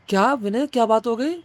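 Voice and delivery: a lightly voiced boss faking concern; fake concerned boss. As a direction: play the boss suddenly all nice. Boss feigns concern after resignation.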